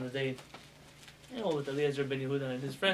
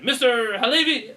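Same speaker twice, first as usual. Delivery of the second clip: loud, high "Mister Halevi" called sharply; teacher calling out; 8 semitones higher, 11 dB louder than usual. Retold or imitated. Teacher scolding a pupil with a loud, high call. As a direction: call the pupil out across the room. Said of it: "the teacher calls you out".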